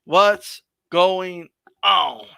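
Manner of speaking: very slow, stretched words; drawn-out greeting. Real, not acted. Host greeting Tone Brown a second time, drawn-out. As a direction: draw out each word, especially the last.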